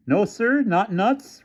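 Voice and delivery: high, suspicious drawl on eh; skeptical officer. A narrator's reading. Officer doubting the developer's story, high and suspicious. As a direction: doubt every word he says. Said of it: "returned the policeman".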